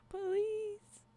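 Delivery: POLICE called out high and drawn out; high mock call. Streamer echoing a chatter who says police, high mock call. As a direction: lift "Police" into a high, put-on cry. Repeats chat's word as a call.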